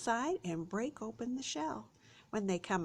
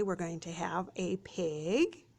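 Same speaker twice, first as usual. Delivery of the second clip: normal talking voice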